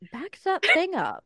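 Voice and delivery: high-pitched parody of a public radio host; NPR voice impression. Host imitating a TikTok lady for her co-host, NPR voice. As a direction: imitate a prim NPR presenter reading rap lyrics. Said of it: "lady on TikTok who does the N P R".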